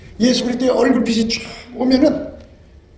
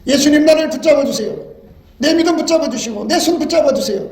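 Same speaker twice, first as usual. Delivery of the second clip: crying out in prayer; 7 semitones higher than usual. Retold or imitated. Preacher voicing a believer crying out to Jesus, pleading. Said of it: "깨닫게 돼갖고 울부짖죠".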